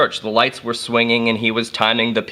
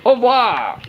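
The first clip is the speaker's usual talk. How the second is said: hammed-up French accent; French crowd; slower than usual. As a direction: play caricature French onlookers, drawing out the words. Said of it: "all the French people say".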